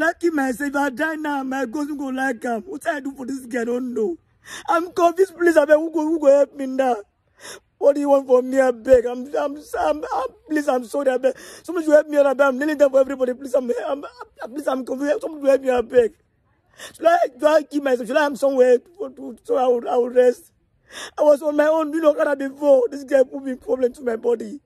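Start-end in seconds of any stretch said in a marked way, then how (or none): 4.7-7.0 sobbing out his confusion, voice raised; real tears breaking through the words; crying plea. Real, not acted. Man begging strangers for help, crying out loud. 7.8-20.5 tearful begging. Real, not acted. Desperate pleading, kneeling for everybody.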